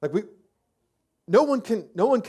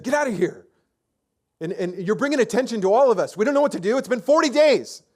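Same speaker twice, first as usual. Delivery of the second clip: higher, louder exasperated scolding; exasperated brother; 6 semitones higher, 3 dB louder than usual. Retold or imitated. Older brother scolding David, exasperated and higher. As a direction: tell the kid to go away, rattled.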